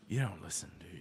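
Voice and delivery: a hushed jab at the listener; whispered quote. Retold or imitated. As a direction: whisper it, voiceless and low. Quoting the therapist scene.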